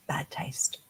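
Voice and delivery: hushed, breathy whisper of the blunt answer; whispered verdict. Retold or imitated. Retelling mentor's blunt verdict to the audience, whispered. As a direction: drop to a whisper for the punchline.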